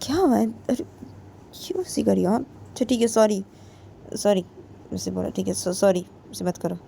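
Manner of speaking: very soft, subdued apology; meek apology. Acted. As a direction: apologize gently under the breath. Soft apology to angry friend. (धीमी, दबी आवाज़ में माफ़ी)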